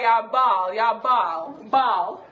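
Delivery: "bawl" said in Jamaican Patois, open "bahl"; patois pronunciation. Shown as a demonstration. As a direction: teach "ya bawl" in Patois, drawn out. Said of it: "How do you say cry in Jamaica".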